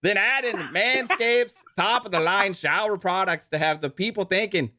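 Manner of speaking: mock Canadian accent reading a sponsor ad. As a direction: sell the razor like a joking Canadian, eh. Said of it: "he's definitely Canadian right now".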